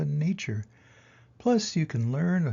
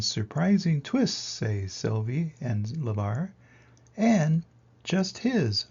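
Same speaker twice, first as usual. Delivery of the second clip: lively read-aloud storytelling voice; story narration; 3 semitones higher than usual. A narrator's reading. Teacher reading a picture book to kids, lively storytelling.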